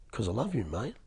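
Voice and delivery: put-on boss's wife telling the young man she loves him, softly sincere; heartfelt conviction. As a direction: say it gently and mean every word. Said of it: "she said it with such conviction".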